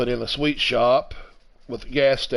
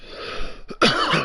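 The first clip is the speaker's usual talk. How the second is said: cough; 6 semitones higher than usual. Real, not acted. Coughing into the mic.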